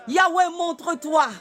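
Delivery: ordinary delivery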